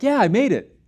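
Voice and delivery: high, loud mock victory shout; imagined hiker's loud, elated whoop; triumphant cheer. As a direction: cheer as if you just summited.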